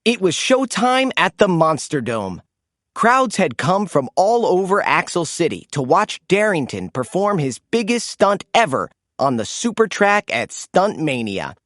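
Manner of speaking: ordinary delivery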